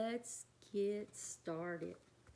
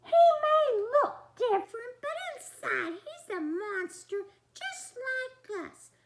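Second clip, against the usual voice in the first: Missus Monster; 12 semitones higher, 9 dB louder than usual. Acted. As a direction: say it like a proud monster mum. Missus Monster continues speaking.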